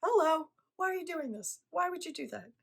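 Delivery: high, incredulous wake-up call, hammed up; playfully voiced Jesus scolding; exasperated Jesus. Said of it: "Jesus is like".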